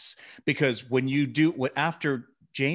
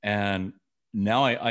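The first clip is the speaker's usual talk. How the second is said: normal talking voice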